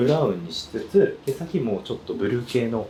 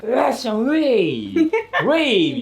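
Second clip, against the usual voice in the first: high slow hyped-up whoop; high, slow party shout; mock hype; 13 semitones higher, 3 dB louder, slower than usual. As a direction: imitate her rowdy morning cheer. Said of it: "朝とか".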